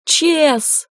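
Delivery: high-pitched shout for help; yelling a name. A narrator's reading. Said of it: "заорала я".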